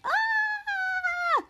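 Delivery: long, thin high scream, held back in volume; high-pitched, softened retold shriek; mock scream. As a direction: imitate screaming on one high held note. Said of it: "Piper screaming".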